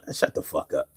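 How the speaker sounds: muttered under the breath; whispered retort to a commenter. Real, not acted. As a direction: hiss it quietly. Muttered response to viewer comment.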